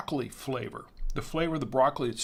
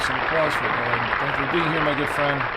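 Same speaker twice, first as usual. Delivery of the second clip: loud announcement; 7 dB louder than usual. Real, not acted. Calling for applause for Ed.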